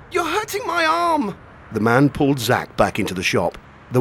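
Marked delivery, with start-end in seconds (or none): 0.1-1.4 put-on high, slow boy's voice; boy voice in pain. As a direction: plead like a hurt teenage boy.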